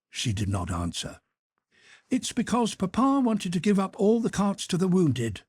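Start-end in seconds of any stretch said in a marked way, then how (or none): 2.1-5.4 put-on higher, eager young boy; boy's curious question. A narrator's reading. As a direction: ask like a curious kid. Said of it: "said Petya".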